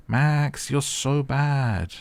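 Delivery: a sing-song mock scolding of Max; teasing scold. Real, not acted. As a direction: chide Max with mock disapproval.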